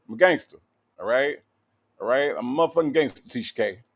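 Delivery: usual speaking voice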